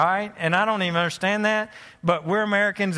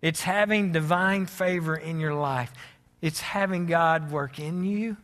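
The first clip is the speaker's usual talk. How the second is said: reflective sermon; 3 semitones lower than usual. Acted. Quiet reflective sermon close.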